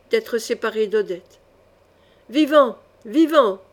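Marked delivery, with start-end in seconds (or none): 2.3-3.6 bellowed by the gang. Acted. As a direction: roar it as a mob. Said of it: "vociféra la bande".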